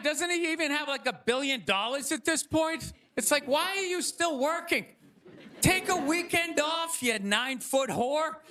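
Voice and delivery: ordinary delivery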